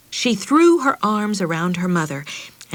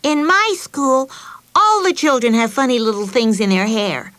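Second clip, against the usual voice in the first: triumphant; 6 semitones higher, 5 dB louder than usual. Acted. Betsy tops her sister's story at dinner, triumphant. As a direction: crow it like you just won. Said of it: "said triumphantly".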